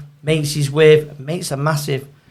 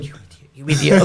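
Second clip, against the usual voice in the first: wife's scolding; much faster than usual. Retold or imitated. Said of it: "my missus going".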